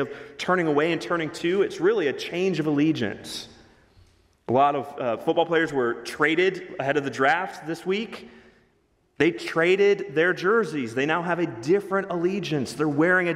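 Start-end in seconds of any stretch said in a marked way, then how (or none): none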